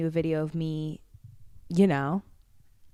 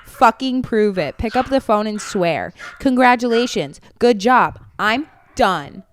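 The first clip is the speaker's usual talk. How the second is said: louder and higher, hammed-up rant; mocking angry ex; 5 semitones higher, 6 dB louder than usual. Reading the ex's email aloud to a friend, hammed-up angry rant. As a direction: sneer through the ex's furious email.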